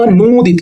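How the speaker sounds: अनूदित with the "noo" held long; drawn-out long oo. Shown as a demonstration. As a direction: stretch the middle vowel of अनूदित. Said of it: "खींच के बोलते हैं".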